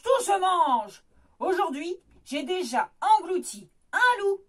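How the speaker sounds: loud, greedy ogre voice; spluttering ogre. Acted. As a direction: boast like a hungry giant. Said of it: "Poustillonne l'ogre".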